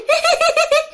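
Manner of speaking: very high-pitched cackle; high staccato spirit laugh; ghostly giggle. Acted. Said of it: "Lại cười tiếp".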